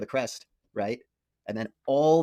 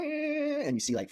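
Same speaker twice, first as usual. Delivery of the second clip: ordinary talk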